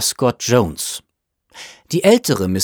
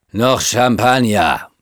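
slow, drawn-out demand from a tipsy guest; calling out. A narrator's reading. Elderly guest interrupting for more champagne, calling out. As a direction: interrupt with a slow call for a refill. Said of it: "rief die ältere Misses Crawford".

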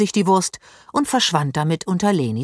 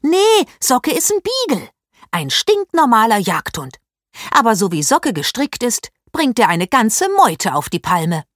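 higher and louder, impatient correction; 5 semitones higher, 4 dB louder than usual. A narrator's reading. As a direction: set him straight, fed up. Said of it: "Paula schüttelte ungeduldig den Kopf".

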